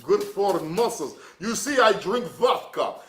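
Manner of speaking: hammed-up Russian accent with broken English; mock Russian accent. Joking to viewers as a Russian vodka drinker, mock Russian accent. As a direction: play a lightly voiced Russian bragging about vodka.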